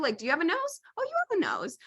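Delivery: rising to a very high delighted "oh"; mock relieved discovery. Calling a friend about basic things, high mock delight. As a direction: play up the silly relief.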